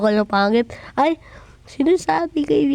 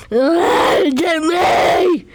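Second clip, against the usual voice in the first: crying shout; 4 semitones higher, much slower than usual. Acted. Wailing for Jemmy in the game. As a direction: sob out the name.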